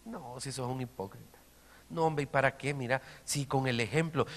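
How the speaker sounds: a lightly voiced, hushed, sneering tempter; lightly voiced devil whispering doubt to a believer; voice of the enemy. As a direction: murmur the enemy's taunts low and soft. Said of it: "viene el enemigo".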